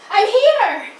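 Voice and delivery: put-on kid's triumphant I'm here; grand entrance. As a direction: belt it like a star making an entrance. Clears throat, tries entrance again.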